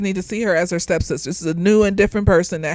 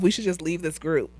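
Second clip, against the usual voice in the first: usual speaking voice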